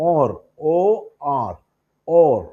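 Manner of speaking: ഓർ, spelled O, R, then ഓർ firmly; spelled-out pronunciation. Shown as a demonstration. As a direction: show ഓർ with a long o, letter by letter. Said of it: "മറ്റൊരു വാക്കുണ്ട്". (ഓർ അക്ഷരം പറഞ്ഞ് നീട്ടി ഉച്ചരിച്ച്)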